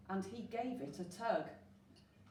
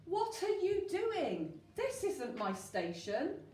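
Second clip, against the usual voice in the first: indignant shout; 9 semitones higher than usual. A narrator's reading. Train driver shouting at Digger, high and indignant. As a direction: bellow your outrage at the intruder. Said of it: "shouted the driver with great indignation".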